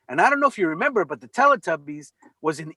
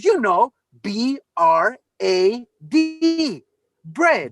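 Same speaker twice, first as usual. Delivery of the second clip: put-on slow, deliberate spelling in South African accent; 3 semitones higher, 3 dB louder, much slower than usual. South African mother spelling her baby's name, South African accent. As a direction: spell it out patiently, then say Brad as "bread", South African-style. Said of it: "the accent that she was speaking in".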